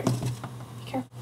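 soft, voiceless whisper; hushed warning under the breath. Real, not acted. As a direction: whisper it like a secret caution. Warning repeated as whispered aside.

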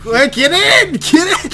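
high, loud urgent yell; shouting urgently. Real, not acted. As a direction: yell at them to get in. Yelling teammates into car.

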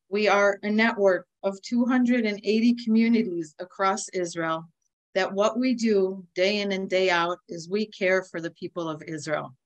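ordinary talk

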